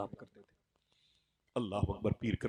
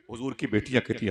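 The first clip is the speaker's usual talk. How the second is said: ordinary talk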